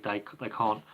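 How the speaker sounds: ordinary talk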